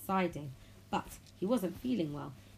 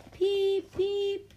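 high, loud whistle peeps; loud train whistle; 8 dB louder than usual. A narrator's reading. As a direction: blow two piercing engine toots. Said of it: "blew his whistle as loudly as he could".

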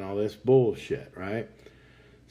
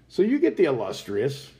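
ordinary delivery

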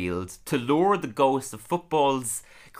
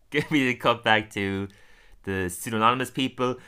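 ordinary delivery

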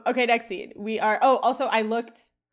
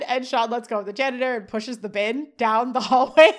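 ordinary delivery

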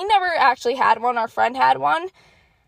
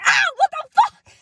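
very high yelp of shock; imitating a friend's screamed outburst; retold startled shriek; 4 dB louder than usual. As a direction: shriek it like someone sent flying. Friend thrown flying off sled.

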